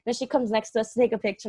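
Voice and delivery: usual speaking voice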